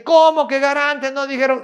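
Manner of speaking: remedo de protesta, mucho más agudo y fuerte; reclamo indignado imitado. Retold or imitated. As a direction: imita a la gente reclamando a gritos, alargando la pregunta. (protesta incrédula y airada, remedada)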